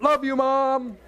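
hammed-up sincere thanks, shirt pulled up; athlete's heartfelt shoutout. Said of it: "Or they pull their shirt".

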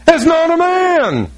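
high-pitched, drawn-out insistence; emphatic denial. Real, not acted. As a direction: protest it high and slow. Preacher emphatically denies news claim.

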